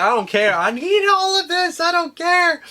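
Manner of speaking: high mock-desperate excitement; retold eager outburst. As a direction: pitch leaping into a high, insistent plea. Retold eager outburst, unable to hide it.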